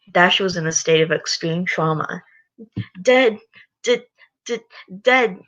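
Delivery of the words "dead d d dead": shaky stuttering panic; traumatized stutter. Acted. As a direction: stammer in shock. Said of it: "She was shaking and crying".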